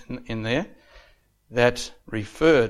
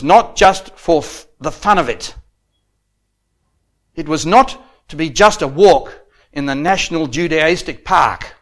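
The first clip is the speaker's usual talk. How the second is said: loud, emphatic pulpit oratory; ringing sermon delivery, key words punched; declamatory preaching; 4 semitones higher, 8 dB louder than usual. Real, not acted. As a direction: drive each phrase home to the congregation. Preaches at raised force.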